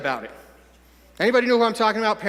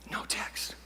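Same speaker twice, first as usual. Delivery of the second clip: dramatic whisper; barely audible, ominous letdown; hushed suspense; 15 dB quieter than usual. Acted. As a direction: whisper it into the silence. Dramatic pause awaiting a reply.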